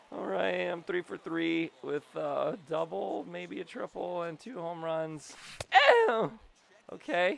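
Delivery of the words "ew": squealed loud ew; high disgusted ew. Real, not acted. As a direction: recoil at the play. Reacting to bad play.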